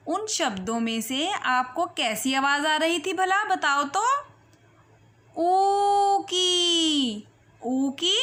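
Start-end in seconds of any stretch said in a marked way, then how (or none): 5.4-7.3 ऊ held long and slow; drawn-out ऊ; long oo sound. Shown as a demonstration. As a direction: stretch ऊ out slowly. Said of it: "लंबी आवाज़ आ रही थी".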